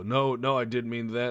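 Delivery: high, loud mock backpedalling; flustered protest. Embarrassed player backpedals to teasing friend, high loud protest. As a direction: imitate someone loudly scrambling to take it back.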